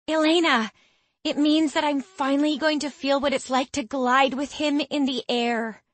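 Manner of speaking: high, eager gushing; giddy excitement. Acted. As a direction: gush with dreamy excitement. Becky gushing about the saddle.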